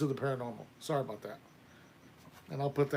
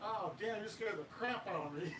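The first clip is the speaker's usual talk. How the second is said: startled, quiet; 5 semitones higher, 10 dB quieter than usual. Real, not acted. Startled by someone arriving.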